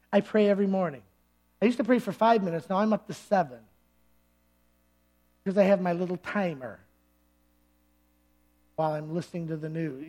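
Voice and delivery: low, soft, offhand self-report; lightly voiced churchgoer quietly pleased with himself; complacent churchgoer. Churchgoer reporting a short prayer routine, complacent.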